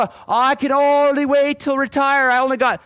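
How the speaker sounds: high, loud, pining for retirement; mock eager retiree. Speaker mimics people waiting to retire, mock longing. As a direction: ham up someone counting the days to retirement. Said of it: "people go".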